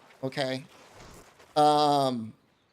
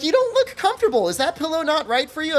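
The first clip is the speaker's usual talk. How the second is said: much higher, concerned saleswoman voice; helpful shop lady; 8 semitones higher, faster than usual. Retold or imitated. Shop lady worrying about his comfort, high concerned voice. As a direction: play the eager clerk fussing over him. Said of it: "she's like".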